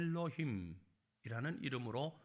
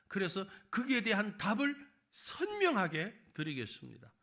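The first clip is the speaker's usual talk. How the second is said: ordinary talk, nothing marked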